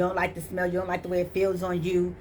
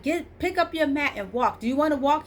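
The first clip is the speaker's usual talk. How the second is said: commanding Lord; 9 semitones higher than usual. Retold or imitated. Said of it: "the Lord's like".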